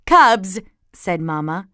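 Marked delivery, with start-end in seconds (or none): 0.1-0.6 loud, sharp motherly warning; firm mom, voice raised; stern mother. A narrator's reading. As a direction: call the children to order. Mama cuts off shouting cubs.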